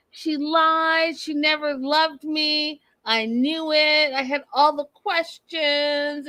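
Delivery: high, drawn-out imitation of distraught wailing; hammed-up hysterics, pitched well up; mock breakdown. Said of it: "She's having a full-on breakdown".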